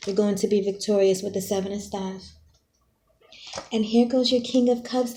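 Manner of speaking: normal talking voice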